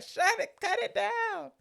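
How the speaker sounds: high, stretched-out mock holler; imitated mom yelling. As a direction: call out slowly like a nagging mother. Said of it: "you hear the mom yelling".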